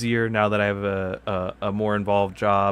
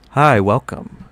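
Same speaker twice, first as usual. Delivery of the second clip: loud bright intro greeting; hammed-up channel host welcome; youtuber intro; 2 semitones higher, 9 dB louder, slower than usual. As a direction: launch into the video host voice. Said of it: "Let's do it".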